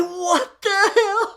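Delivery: high, loud, drawn-out exclamation; outraged shock. Retold or imitated. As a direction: relive the shock at full volume. Stunned by being hung up on.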